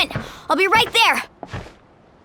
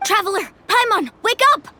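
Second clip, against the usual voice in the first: urgent wake-up call; 8 semitones higher than usual. Acted. Waking them during attack.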